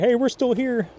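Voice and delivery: high little voice for a fish; lightly voiced fish. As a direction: pipe up lightly as the forgotten fish. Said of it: "He's like".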